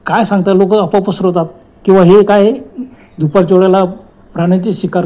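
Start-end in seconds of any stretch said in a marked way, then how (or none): none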